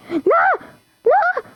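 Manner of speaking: sudden high yelp of no; high panicked no, no; panicked cry. Real, not acted. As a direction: shriek it in a panic. Gamer panics in match.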